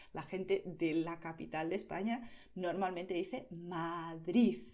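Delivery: usual speaking voice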